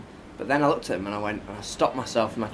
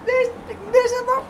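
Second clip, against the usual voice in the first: shrill, much higher mock scream; retold screaming woman. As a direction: shriek it like the furious woman at the fight. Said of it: "this woman comes up and goes".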